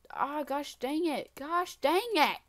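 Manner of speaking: high, drawn-out exasperated cry; frustrated outburst. Real, not acted. Player just fell off, frustrated outburst. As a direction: let the frustration out, stretching the words.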